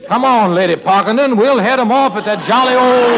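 put-on English lines slipping into a Western accent; Western drawl. Pupil trying an English love scene, slipping into Western accent. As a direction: play Lady Parkington's suitor with a cowboy's Western accent. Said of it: "What's all that Western talk in there".

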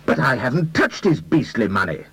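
protest, close to screaming; a mild mock near-scream; almost screaming. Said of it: "the young man almost screamed".

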